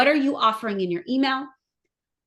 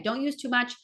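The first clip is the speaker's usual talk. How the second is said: normal talking voice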